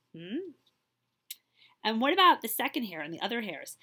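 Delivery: ordinary delivery, nothing marked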